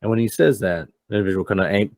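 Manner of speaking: normal talking voice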